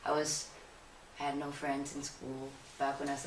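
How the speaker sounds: usual speaking voice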